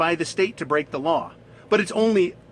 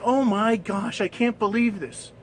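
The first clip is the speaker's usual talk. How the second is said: higher-pitched mock delight; acted amazement; 7 semitones higher than usual. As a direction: play a woman thrilled by her luck. Woman granted three wishes.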